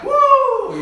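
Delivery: loud high whoop at a game; sports fan whoop; basketball cheer. Retold or imitated. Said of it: "then I'm like".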